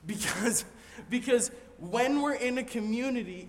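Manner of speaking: pushing forward with eager energy; getting excited. Real, not acted. Pastor carried away while preaching to congregation, excited. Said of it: "I'm getting a little excited".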